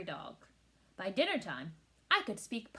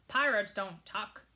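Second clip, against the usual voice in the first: slow, louder pirate refusal; pirate growl; 3 dB louder, slower than usual. A narrator's reading. Captain refuses to tuck in, mild growl. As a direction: lay down pirate law slowly. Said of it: "he bellowed".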